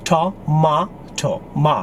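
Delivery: "tomato" slowly as to-mah-to, then "ma" alone; British pronunciation. Shown as a demonstration. Teacher showing the British syllable, British pronunciation. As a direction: break "tomato" up with an MAH middle.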